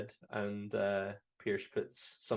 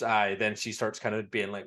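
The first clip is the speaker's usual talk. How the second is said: normal talking voice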